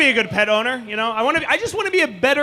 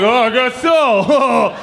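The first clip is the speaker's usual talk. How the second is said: sheepish laugh; 6 dB louder, much slower than usual. Retold or imitated. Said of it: "He's like".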